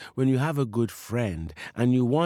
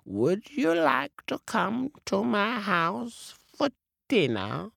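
high, sweet cat voice; a cat inviting the parrot to dinner, in a high voice; 11 semitones higher than usual. Acted. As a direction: sound like a sweet-talking cat. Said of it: "and says to the parrot".

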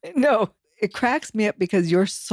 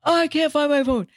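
high, fretful mock whine; imitating husband; 10 semitones higher than usual. Wife imitating husband grumbling that he lost his phone. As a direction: play him grumbling about the phone. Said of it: "lots of swear words".

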